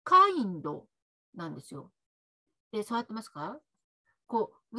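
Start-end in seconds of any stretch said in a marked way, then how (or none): 0.0-0.8 "kind" as Japanese kaindo, with an added o; Japanese-accented "kind", ending in a clear vowel; katakana English. Shown as a demonstration. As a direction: add a full "do" to the end of "kind". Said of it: "これカタカナ英語だと".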